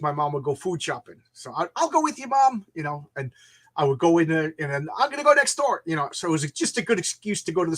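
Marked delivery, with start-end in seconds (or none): 1.7-2.6 higher-pitched, eager offer to Mom; lightly put-on higher voice; young self to mom. Voices himself as a kid. 5.0-5.8 higher, casual call to Mom; lightly put-on kid announcing an errand; young self to mom. Voices himself as a kid.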